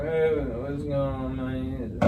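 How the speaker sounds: slow, soft, lazy just-woke-up drawl; soft, slow groggy morning greeting. Shown as a demonstration. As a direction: let the words slur together, half asleep. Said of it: "real lazy when you're talking".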